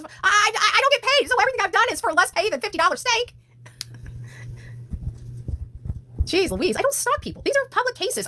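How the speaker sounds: high-pitched, indignant and fast; indignant rant. Real, not acted. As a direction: protest the accusation, voice way up. Heated defense to commenter.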